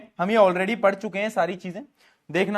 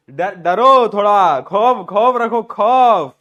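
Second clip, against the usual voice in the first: slow, booming mock threat; mock intimidation; 8 dB louder, much slower than usual. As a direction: shout each word long, playing scary. Playfully orders students to fear him. (नकली डराने वाली ज़ोरदार आवाज़)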